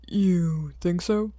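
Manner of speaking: slow, weary echo; way too done. A narrator's reading. Tired hero echoes the boy, slow and weary. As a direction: drag it out, utterly done. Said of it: "sounding way too done".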